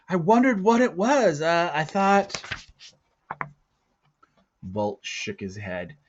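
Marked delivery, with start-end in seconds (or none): 0.1-2.4 put-on high, bright-eyed boy rushing his words; a boy eager to ask. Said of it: "said the boy eagerly".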